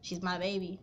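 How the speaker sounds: ordinary talk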